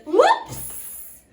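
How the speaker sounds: high, loud exclamation; a big storybook oops; clumsy whoops. A narrator's reading. Clumsy dwarf has just fallen down, loud startled whoops. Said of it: "The clumsy dwarf falls down".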